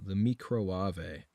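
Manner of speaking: slow, low mock of "the Microwave" as "mee-crow-ah-vay"; mocking posh microwave. Streamer mocking the British cook's microwave, slow and low.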